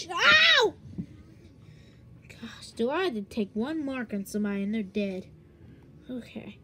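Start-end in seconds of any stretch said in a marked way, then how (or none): 0.1-0.7 high "ow" yell; sudden high cry of pain; pained yelp. Pretend. Cries out when hurt.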